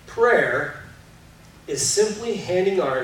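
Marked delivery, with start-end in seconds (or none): none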